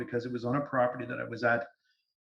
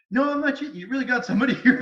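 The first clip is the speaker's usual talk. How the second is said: much higher, louder, fast with a laugh; excited protest; 10 semitones higher, 6 dB louder, faster than usual. Retold or imitated. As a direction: blurt it out, laughing in disbelief. Retelling own excited protest.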